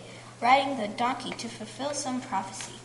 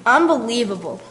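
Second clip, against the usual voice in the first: a louder shocked exclamation; loud disbelief; 11 dB louder than usual. Acted. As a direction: marvel at the news. Shocked villagers trading gossip.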